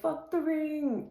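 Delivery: high, slow mock [name] mumble; imitating [name]. Fan quoting [name]'s clip in high, slow mock voice. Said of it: "he's like".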